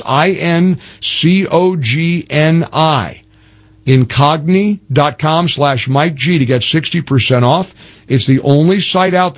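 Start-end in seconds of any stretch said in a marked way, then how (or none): 0.0-3.2 slow, letter-by-letter spelling; spaced-out letters for listeners to catch. Shown as a demonstration. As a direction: spell it slowly so every letter is clear. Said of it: "let me just clarify in case you didn't get the spelling".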